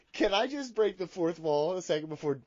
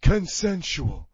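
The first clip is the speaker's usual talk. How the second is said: Big Johnny voice. Acted. Big Johnny answers the host's accusation, defensive character voice. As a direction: deny it in character, drawing out consensual. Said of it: "but Big Johnny".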